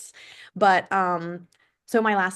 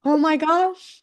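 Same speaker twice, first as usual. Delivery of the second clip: high, loud embarrassed gasp of words; mock shock; 9 semitones higher, 5 dB louder, slower than usual. Real, not acted. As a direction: squeal it in embarrassment. Embarrassed reaction to accent teasing.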